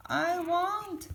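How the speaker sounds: high, emphatic yell; acted shout of reassurance; SpongeBob. A narrator's reading. SpongeBob answering Patrick's plea for help, yelling. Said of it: "yelled SpongeBob".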